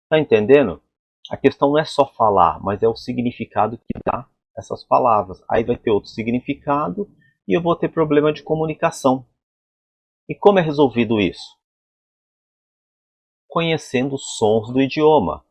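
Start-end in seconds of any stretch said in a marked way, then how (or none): none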